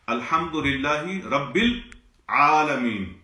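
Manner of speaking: العالمین said slowly with a clear throaty ain after il, no lam; teacher demo of العالمین with ain, not lam. Shown as a demonstration. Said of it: "رب العالمین پڑھنا درست ہے".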